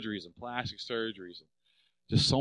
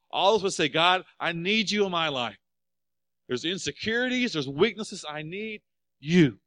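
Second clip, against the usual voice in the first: high, loud preaching climax; 7 semitones higher, 8 dB louder than usual. Acted. Pastor urging the church to cry out, loud and high. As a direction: lift it up to the congregation.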